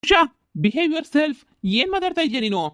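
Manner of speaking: stern scolding. Acted. Scolding the ex-lover.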